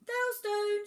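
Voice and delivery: very high, loud squeal; tiny island piping up high; third island's voice. A narrator's reading. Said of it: "said a third in a voice".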